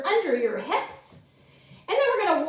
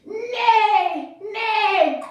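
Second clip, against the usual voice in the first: higher, louder neigh, falling away; playful horse neigh; 5 semitones higher, 4 dB louder than usual. Pretend. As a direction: neigh out while galloping. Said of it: "let's gallop through that field".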